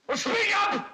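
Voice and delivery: sharp, high demanding shout; angry shout. Acted. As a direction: bark the order at him. Father demanding an answer.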